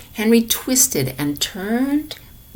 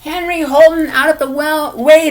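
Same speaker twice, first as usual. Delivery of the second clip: loud, high exasperated yell; family yelling at Henry to move; angry yell; 6 semitones higher, 6 dB louder than usual. A narrator's reading. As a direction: shout him off the ice. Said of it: "they yelled".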